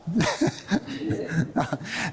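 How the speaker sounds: soft chuckling laugh; real laugh. Real, not acted. Speaker amused after his own aside, laughing. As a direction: break into a quiet wordless laugh.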